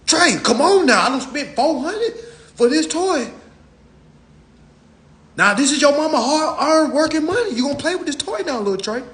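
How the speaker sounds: high pleading mama voice; mock pleading mother. Mother begs Trey to play with expensive toy, high and pleading. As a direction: beg the kid to love the gift.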